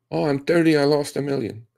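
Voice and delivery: high, whiny mock victim; mock whine. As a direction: parody the crying loser, high. Mocking a whining loser.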